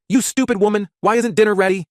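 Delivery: high-pitched, loud angry yell. A narrator's reading. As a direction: yell it like a child playing an enraged husband. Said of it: "before yelling".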